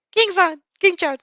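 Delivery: high, loud call across the crowd. A narrator's reading. Uraraka calling out to her friend, high and loud. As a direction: shout the name to get attention. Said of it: "Uraraka's voice snaps you out".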